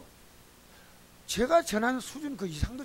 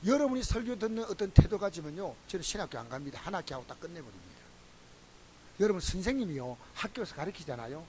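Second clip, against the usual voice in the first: ordinary talk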